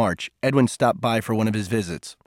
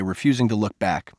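ordinary delivery, nothing marked

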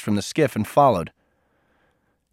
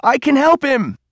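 much higher and louder, desperate; screamed; 13 semitones higher, 6 dB louder than usual. A narrator's reading. Cole screaming after Edwin's boat, loud and desperate. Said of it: "Cole screamed across the water".

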